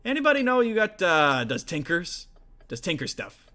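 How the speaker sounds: loud, high orc brother voice; grumpy orc smith. Acted. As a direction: shout it across the forge. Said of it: "He goes".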